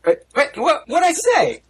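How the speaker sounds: high-pitched, baffled stammer; squeaky-high bewildered protest; startled protest. Real, not acted. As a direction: stumble into a high, incredulous objection. Singled out, protests in mock alarm.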